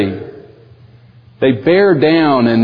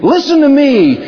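clamouring crowd voice; 9 semitones higher than usual. Retold or imitated. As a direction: shout over everyone for attention. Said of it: "all of these voices out there are waving their hands and they're saying".

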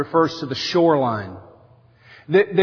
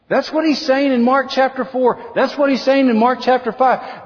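ordinary talk, nothing marked

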